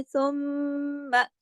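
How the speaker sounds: सुम्मा with a long nasal doubled meem; full ghunna. Shown as a demonstration. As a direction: hold the meem of सुम्मा in the nose. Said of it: "गुन्ने का पूरा हक अदा करना है".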